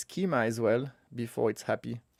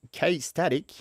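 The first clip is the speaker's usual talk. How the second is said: Aussie vowels, a little playful; Aussie accent; 3 semitones higher than usual. Real, not acted. Tutorial host naming the tool, slipping into an Aussie accent. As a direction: slip into an Australian accent. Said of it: "I said it with the Aussie accent".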